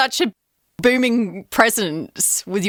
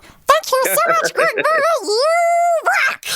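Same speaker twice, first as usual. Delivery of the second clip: very high, manic cartoon chipmunk; high-pitched demented rodent squeak; 3 dB louder than usual. Shown as a demonstration. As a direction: squeal it like a crazed chipmunk, words stretched. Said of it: "I'll do my unique chipmunk voice".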